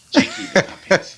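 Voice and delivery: high squeaky puppet voice; squeaky ventriloquist dummy. Pretend.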